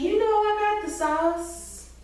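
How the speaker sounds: high, slow put-on voice; mock Marcus swagger. Scene: imitating Marcus bragging about his sauce, high and slow.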